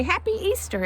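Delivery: high voice for the hens; lightly voiced cheery chicken; chickens' greeting. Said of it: "The chickens say".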